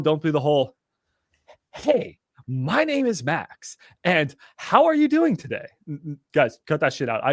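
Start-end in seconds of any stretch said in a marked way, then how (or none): 1.7-5.7 high, slow, sing-song telemarketer voice; mocking his own old salesy call start; mock salesman greeting. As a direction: ham up a cheesy salesman phone opener. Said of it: "you sound like you're a salesman".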